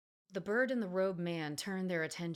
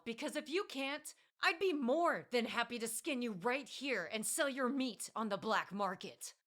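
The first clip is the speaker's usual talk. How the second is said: furious tirade; 7 semitones higher than usual. Acted. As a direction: tear into him for ruining everything. Elf rages at the birdman.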